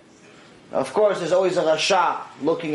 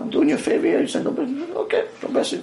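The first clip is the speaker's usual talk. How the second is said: higher, half-hearted mock disbelief; a touch of "are you serious?", pitched up; incredulous protest; 10 semitones higher, faster than usual. Mother protests the strange condition.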